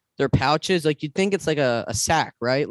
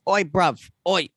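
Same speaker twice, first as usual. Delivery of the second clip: a lightly put-on British accent, higher; mock British accent; 4 semitones higher, slower than usual. Friend imitating how Boris Johnson talks, mild British accent. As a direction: play a cheeky British bloke in a mild British accent. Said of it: "he talks like this".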